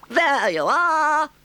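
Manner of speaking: very high, loud, drawn-out cry; cried out. A narrator's reading. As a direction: show off the cart with a triumphant shout. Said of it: "cried the toad".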